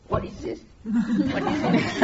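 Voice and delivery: laughing parody of forced questioning; mock forced questioning. Shown as a demonstration. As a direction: parody straining at the question from the head. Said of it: "That's not the idea".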